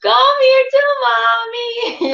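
put-on slowed, high-pitched baby talk to a little dog; doting baby talk. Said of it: "I'm always like".

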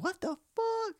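shocked outburst. Retold or imitated. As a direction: blurt it out in pure shock. Bird hits the window.

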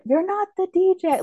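high-pitched mock yell; retold yell. Retelling John's line to Smitty driving away, mock yell. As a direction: imitate yelling it, pitch jumping way up. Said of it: "like, yells it".

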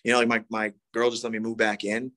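ordinary talk, nothing marked

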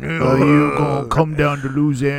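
loud, mumbled mock Cajun coach. Co-host mimics a Louisiana coach recruiting, hammed-up impression. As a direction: garble it in a put-on Louisiana accent. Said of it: "trying to play for Coach O".

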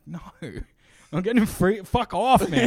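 hammed-up fed-up refusal; mock refusal. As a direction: shoo him away laughing. Refusing the drunk's offer.

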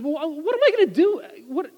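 a caught-off-guard judge at a loss; imitating a stumbling, bewildered judge; flustered judge. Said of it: "the judge was just kinda, like, stumbling".

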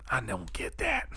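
whispered, breathy aside; mock whisper. Joking with friend, whispered mock confusion. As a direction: hush it like a secret.

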